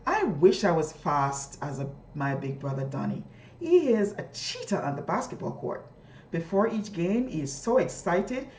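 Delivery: put-on lively reading to children; storybook reading voice; expressive read aloud. Shown as a demonstration. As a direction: read it like a model reader for kids. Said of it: "listen to me as I read".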